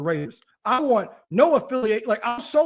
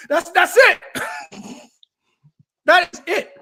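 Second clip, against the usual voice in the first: laughing through the words, higher and louder; 9 semitones higher, 5 dB louder than usual. Real, not acted. Host wrapping up the Eagles rant with the audience, laughing. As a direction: crack up while closing out the rant.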